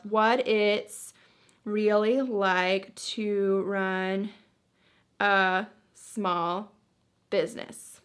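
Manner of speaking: slow, spaced-out words while typing; distracted, halting dictation pace; typing aloud. Real, not acted. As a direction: say it word by word as you type, drawn out.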